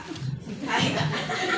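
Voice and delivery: light breathy chuckle; a quick self-amused laugh; short laugh. Real, not acted. Laughing at own story.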